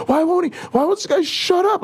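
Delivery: very high mock-exasperated squawk; hammed-up whiny outrage; losing his shit. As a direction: imitate a fed-up heckler, pitch way up. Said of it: "he just was losing his shit".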